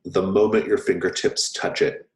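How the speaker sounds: ordinary delivery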